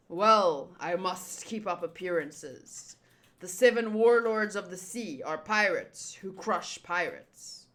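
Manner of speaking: low, deliberate pirate lord; menacing low boss voice; villain voice. Acted. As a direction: speak low and self-important, stretching the key words. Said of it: "Who's this voice?".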